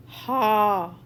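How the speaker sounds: Ha breathed from deep in the throat; throaty Ha. Shown as a demonstration. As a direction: push the Ha from the throat. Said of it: "more emphasis on throat".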